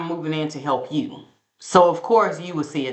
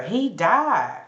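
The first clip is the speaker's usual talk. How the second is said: usual speaking voice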